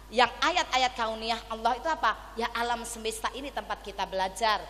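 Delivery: ordinary talk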